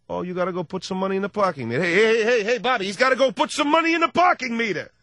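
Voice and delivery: mock outrage, rising to a yell; hammed-up Italian tirade; Italian getting loud. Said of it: "they start out soft and they just, they end up loud".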